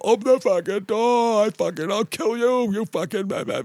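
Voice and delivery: very high mock drunk ranting; imitating drunk yelling. Drunk Jim pounding on the door, very high mock rant. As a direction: squeal it way up like a drunk raging at a door. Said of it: "just fucking pounding on the door".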